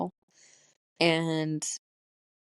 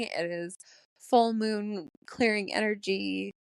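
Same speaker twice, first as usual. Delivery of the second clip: ordinary delivery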